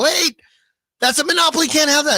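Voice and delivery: high, loud mock outrage; mocking Fanatics. Host mocking Fanatics complaining about monopoly, high mock outrage. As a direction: play the indignant company, hammed-up. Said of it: "they go".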